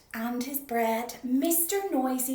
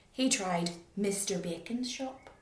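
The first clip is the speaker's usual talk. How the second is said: ordinary delivery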